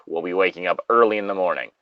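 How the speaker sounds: ordinary delivery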